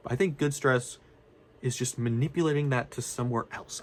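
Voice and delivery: normal talking voice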